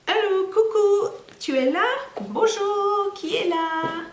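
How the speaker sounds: high playful coucou; calling into bag. Acted. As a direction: call out to find a puppet friend. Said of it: "calling into the magic bag".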